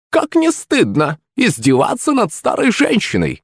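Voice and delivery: high, slow pouting crone; sulky old witch. Acted. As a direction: sulk like a sly old woman. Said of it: "надулась хитрая бабка".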